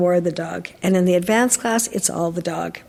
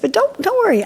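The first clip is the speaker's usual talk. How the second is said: much higher, bright eager promise; eager reassurance; 12 semitones higher than usual. Retold or imitated. Trainer reassuring her sister about the puppy, eagerly. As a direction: rush to calm her worry.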